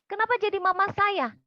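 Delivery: high, loud indignant demand; angry shout. Retold or imitated. Client challenges counselor about mama being brought in, angry shout. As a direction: imitate an upset client yelling back. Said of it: "tiba-tiba dia teriak, dia marah".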